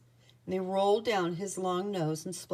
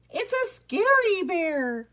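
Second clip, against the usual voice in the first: high, drawn-out loud cry; panicked alarm; 11 semitones higher, 5 dB louder than usual. A narrator's reading. Rat Pack calling for help from Boris, panicked alarm. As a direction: wail it like a terrified storybook rat. Rats panic at sight of bear.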